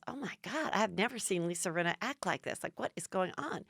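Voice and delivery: very soft, stunned imitation; quiet, imitated disbelief; hushed mock shock. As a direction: say it under your voice, as if taken aback. Sutton's stunned aside, acted.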